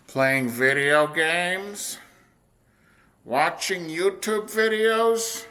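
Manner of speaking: slow, rising, drawn-out questioning; mock despairing question. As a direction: stretch it out in mounting disbelief. Acted self-questioning about his skills.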